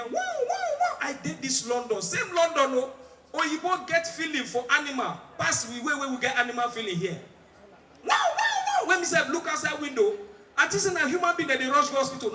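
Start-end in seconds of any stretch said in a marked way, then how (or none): 0.0-1.0 high siren wail, wow-wow; imitated ambulance siren. Comedian mimics the siren he heard in London, high wailing imitation. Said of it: "When you hear". 8.0-8.9 very high wailing wow-wow; imitated siren. Comedian mimics a London siren for the crowd, high wail.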